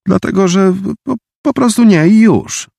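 high, loud stubborn answer; defiant boy. A narrator's reading. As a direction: dig in and refuse. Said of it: "odparł hardo Tomek". (hardo, wysoko i głośno)

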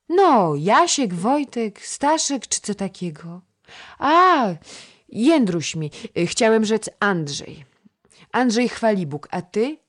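put-on a chattering little girl coaxing the boy to play; little girl Krysia. Girl and boy dialogue voiced. (wysoki głosik małej dziewczynki)

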